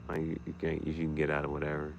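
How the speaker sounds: ordinary talk, nothing marked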